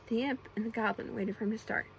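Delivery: ordinary delivery, nothing marked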